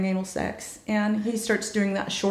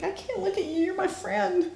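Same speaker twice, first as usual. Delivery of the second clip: high-pitched mock-embarrassed squeal; high, awkward plea, played for comedy; squeamish protest; 11 semitones higher, 3 dB quieter than usual. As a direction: protest in a high little whine to a friend. Squeamish protest to friend, re-enacted.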